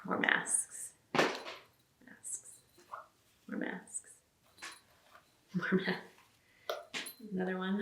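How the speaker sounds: whispered. Acted. Showing stocking stuffers.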